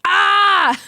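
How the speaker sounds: loud high excited shriek of recognition; excited scream. Retold or imitated. Friend spotting her across the party, loud excited shriek. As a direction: shriek like you just spotted an old friend. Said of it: "pointing at me like this".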